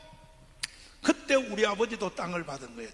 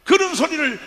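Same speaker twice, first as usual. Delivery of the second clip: preaching at full force; 7 semitones higher, 14 dB louder than usual. Acted. Preacher rousing the rally crowd to rise up, full-force shout.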